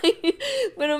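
normal talking voice

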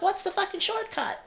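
high mock little-kid voice. Young kid voiced, high squeaky.

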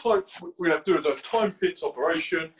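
lightly mocked Cockney tough-guy accent; forced Cockney hard man. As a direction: imitate a try-hard Cockney soldier. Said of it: "the most forced Cockney hard man accent".

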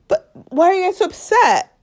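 high, whining protest; imitating a wounded, baffled celebrity; mock bewilderment. Voices the couple's bewildered protest.

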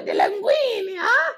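very high squealing mock-Italian kid; Italian child. Child delighted by mother's linguini, squeaky Italian accent. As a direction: wail it in a hammed-up Italian accent. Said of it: "I'm your Italian child".

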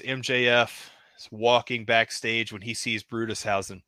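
ordinary talk, nothing marked

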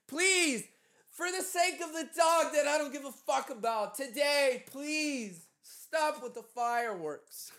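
hammed-up whiny begging; mock desperate plea. Begging neighbors to stop fireworks.

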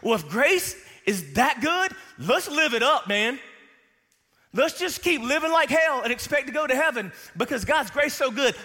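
high, loud, carefree party voice; mock the lazy sinner, high and loud; mocking a careless believer. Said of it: "In other words".

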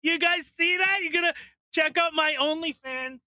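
overexcited attention seeker act; shrieking influencer parody; mock influencer squeal. Mocking attention-seeking influencer.

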